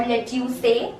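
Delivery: "lettuce" said le-tyoo-say, three syllables; mispronunciation. Shown as a demonstration. Teacher quizzing learners, "lettuce" wrongly as le-tyoo-say. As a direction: add a say ending to "lettuce".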